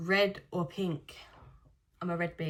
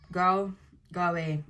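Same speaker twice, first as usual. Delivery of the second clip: mock dismissive; 6 dB louder, slower than usual. Teasing dismissal of question.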